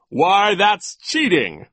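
high, slow, loud pouncing; delighted gotcha; gleeful accusation. A narrator's reading. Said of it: "grinning in delight".

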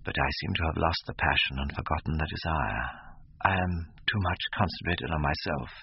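low, soft note of pathos; deep pathos. A narrator's reading. Young man confessing lost passion to his friend, with pathos. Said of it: "with a deep note of pathos in his voice".

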